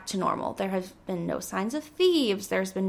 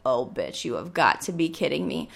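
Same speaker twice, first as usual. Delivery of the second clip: low incredulous; 7 semitones lower, 4 dB quieter than usual. Retold or imitated. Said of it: "And I was like".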